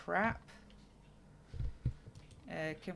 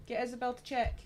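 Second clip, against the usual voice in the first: raised, louder voice to carry an instruction; calling out; 6 semitones higher, 3 dB louder than usual. Real, not acted. Calling instructions to own child.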